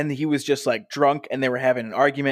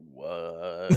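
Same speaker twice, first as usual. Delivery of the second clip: stunned what; 9 semitones lower, 5 dB quieter than usual. Retold or imitated. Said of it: "a pause for everybody to go".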